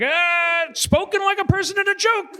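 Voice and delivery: very high, sustained mock cry; jeering seal. Seal's parting shot to the human, jeering. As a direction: jeer it far above the normal voice.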